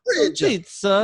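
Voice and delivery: a silly caller who stretches every word; a hammed-up pizza caller; mock high caller voice.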